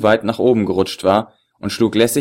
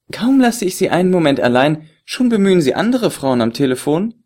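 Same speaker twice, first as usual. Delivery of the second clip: higher, teasing feminine voice; woman's voice; 8 semitones higher than usual. Acted. The beauty teases the agent about his phone call, playfully higher.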